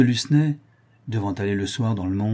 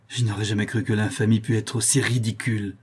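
plain reading voice

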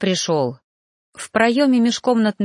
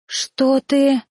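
higher, startled gasp of a question; 5 semitones higher than usual. Acted. Heroine startled to see Noan behind her, gasping. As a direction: catch your breath on it. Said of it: "ахнула я".

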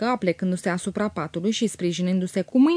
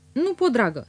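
usual speaking voice

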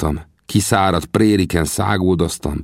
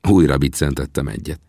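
usual speaking voice

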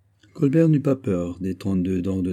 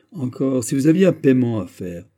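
sharp, irritated and higher; irritated retort; 4 semitones higher, 6 dB louder than usual. Acted. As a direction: push back, vexed. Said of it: "s'écria Colbert irrité". (réplique irritée, plus aiguë)

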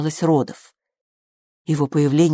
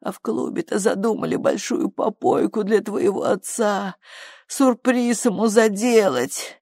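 distraught working-class mother; 6 semitones higher than usual. A narrator's reading. Flo dreading Poplar's mockery of her husband, distraught lament. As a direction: lament the shame, building in agitation.

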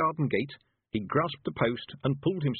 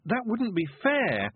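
high, drawn-out plea; a slow, high cry of unfairness; desperate protest; 12 semitones higher, slower than usual. A narrator's reading. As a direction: protest desperately, pitched well up. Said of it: "protested desperately".

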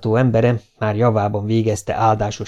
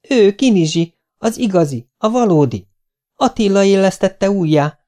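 put-on high, eager boy insisting; felszabadult bizonygatás; 10 semitones higher, 4 dB louder than usual. A narrator's reading. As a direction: insist with relief, pitched high. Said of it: "Felszabadultan bizonygatta". (felszabadultan, lelkesen bizonygatva)

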